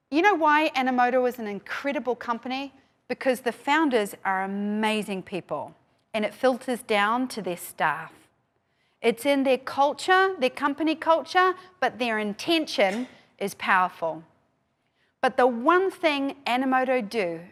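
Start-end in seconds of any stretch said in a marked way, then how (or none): none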